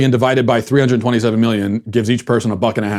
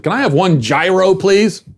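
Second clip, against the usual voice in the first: higher, naively cheery mock order; imitating himself ordering; 6 semitones higher, slower than usual. Host replays ordering at the food truck, naive mock politeness. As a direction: chirp it like an oblivious customer. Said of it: "gone up and said".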